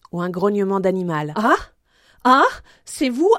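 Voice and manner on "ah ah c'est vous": high, rising cries of surprise; startled recognition. Acted. Player giving a cry to be recognised, surprised and rising. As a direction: exclaim as you recognise someone.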